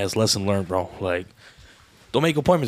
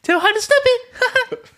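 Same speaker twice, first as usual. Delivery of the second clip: slow squeaky giggling voice; high laughing falsetto; 4 dB louder, slower than usual. Acted.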